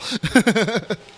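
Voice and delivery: loud laugh jumping up high. Real, not acted. Laughing at the casino ID story, loud and high.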